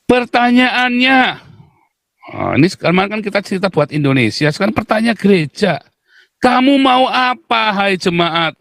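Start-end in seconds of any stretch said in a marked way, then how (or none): 0.0-1.2 drawn-out high call. Real, not acted. Dramatic drawn-out opener. 6.4-8.6 calling out. Real, not acted. Calling out to the congregation.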